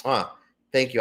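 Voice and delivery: normal talking voice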